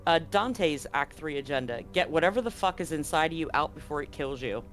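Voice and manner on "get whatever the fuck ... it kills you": normal talking voice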